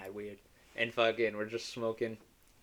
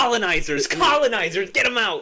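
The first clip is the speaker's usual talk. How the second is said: mocking shout, much higher and louder; mock yell; 10 semitones higher, 10 dB louder than usual. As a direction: chant it like an angry crowd. Mocking locals yelling at tourists.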